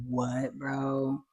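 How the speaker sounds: hushed, unimpressed aside; soft mock disbelief; acted baffled deadpan. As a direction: drop the volume, as if dumbfounded.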